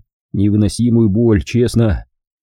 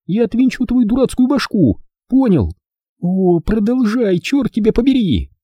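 high young woman's voice, hammed-up rage; furious girl; 12 semitones higher than usual. A narrator's reading. Hilda curses Rick in a high mock fury. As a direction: rant at him as the outraged girl.

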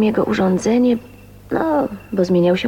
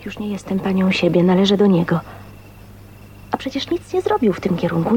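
usual speaking voice

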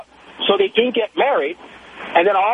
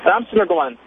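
ordinary talk, nothing marked

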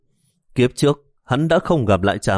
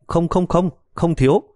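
quick flustered no, much higher; hasty refusal; 8 semitones higher than usual. Acted. Son hurriedly stops mother offering money, flustered higher refusal. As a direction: rush to stop her, pitch jumping up. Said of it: "vội vàng ngăn lại".

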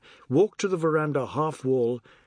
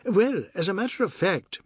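higher-pitched, brisk opener; 7 semitones higher than usual. Acted. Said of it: "Mister Biswas said briskly".

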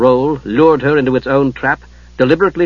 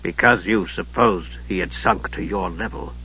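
hoarse; 6 semitones lower, 7 dB quieter than usual. Acted. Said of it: "Steele said hoarsely".

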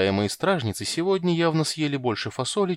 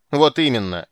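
loud angry retort; 3 semitones higher, 8 dB louder than usual. A narrator's reading. Geralt angry.

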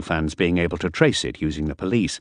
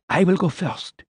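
higher, fussy man's voice; fussy character voice; 11 semitones higher than usual. Acted. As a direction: lecture it in a higher voice. Miller's voice.

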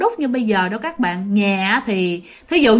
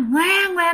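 high-pitched mimicked newborn wail; imitated baby cry; 7 semitones higher than usual. Teller imitating a ghostly baby's cry by the ear, mock wail. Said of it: "nó khóc mà giống như".

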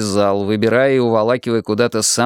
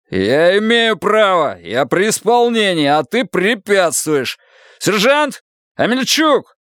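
angry authority; 8 semitones higher, slower than usual. Acted. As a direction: assert power, then call the sergeant. Officer asserts authority, calls sergeant.

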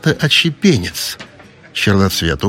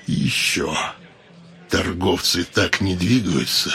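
low, slow and cold elf voice; low, measured menacing drawl; dark elf voice; 5 semitones lower, 6 dB quieter than usual. A narrator's reading.

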